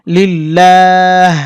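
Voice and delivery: লিল্লাহ drawn out very slowly, final h breathed from the throat; h sound voiced. Shown as a demonstration. Teacher drawing out লিল্লাহ slowly for students. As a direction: stretch লিল্লাহ and let the h come from the throat. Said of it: "এভাবে হাও আসবে".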